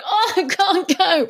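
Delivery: high, slow whining moan; whiny Susan.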